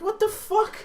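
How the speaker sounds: very high imitated female squawk; incredulous retort. Woman reacting to man sitting on himself, high incredulous retort. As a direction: squeak it in mock disbelief.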